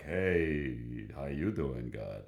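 mock-casual hello, pitched low; casual greeting. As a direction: greet someone mighty like an old buddy. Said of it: "Not like".

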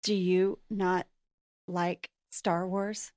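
hushed, slow shocked question; stunned disbelief. Real, not acted. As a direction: ask it slow, barely believing.

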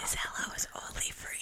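breathy whisper to friends; stage whisper. Acted. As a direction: whisper it like a secret greeting. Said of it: "a whisper shout out".

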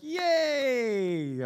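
put-on a forced, half-hearted little cheer for the kid; mock cheer. Pastor cheering a toddler at the fridge, forced mock cheer. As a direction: pretend to be thrilled at a cup of water. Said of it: "I'm like".

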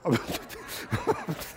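audience laughing softly; crowd laughter. Real, not acted. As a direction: congregation chuckling. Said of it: "you can laugh".

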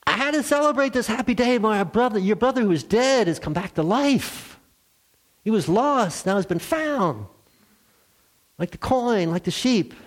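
high, bright joy; a father lifted high with joy; joyful father. Acted. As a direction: share the celebration happily. Father explains joyful celebration.

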